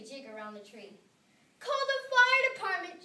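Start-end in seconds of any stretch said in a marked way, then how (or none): 1.6-3.0 very high, loud panicked cry. A narrator's reading. Missus Bisbee crying out for her cats, very high and loud. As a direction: call for help in a frenzy. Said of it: "she cried".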